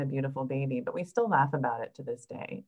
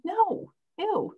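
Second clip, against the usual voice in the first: disgusted ew. Retold or imitated. Doctor retells her disgusted reaction.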